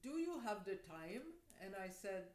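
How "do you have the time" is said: imitated Indian-accented English, lilting. Little man asks a stranger at the bus stop, Indian accent. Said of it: "he speaks with an accent".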